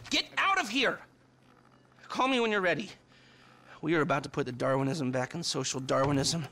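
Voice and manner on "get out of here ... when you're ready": yelling at his partner to get going; excited shout. Acted. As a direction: bark it out, fired up. Sending Michael off excitedly.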